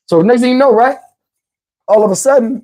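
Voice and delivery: ordinary talk, nothing marked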